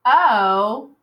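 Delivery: "O" stretched out and punched; long O sound. Shown as a demonstration. Teacher sounding out hope, "O" held long. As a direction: draw out "O" as a long vowel. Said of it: "I made that long O sound".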